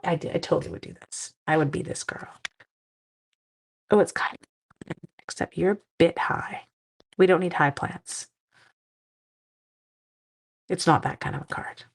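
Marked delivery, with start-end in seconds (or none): none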